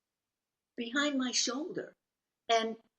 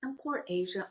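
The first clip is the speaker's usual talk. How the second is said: ordinary delivery, nothing marked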